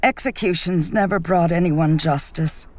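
lightly voiced stranger, firm; another voice. Someone else at the gathering, lightly voiced and firm. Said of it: "someone else said".

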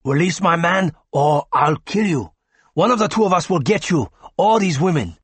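threaten, but with nerves showing; young gunman shouting at the doctor; nervous shout. A narrator's reading. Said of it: "shouted the nervous young Oriental".

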